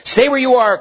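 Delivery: high, loud cry. Acted. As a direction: call out sharply to stop her. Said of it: "cried Diggory".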